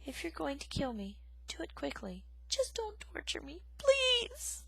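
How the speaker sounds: soft, high tearful pleading; a mouse pleading tearfully to die quickly. Acted. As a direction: beg quietly through tears. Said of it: "Poppy gazed up tearfully".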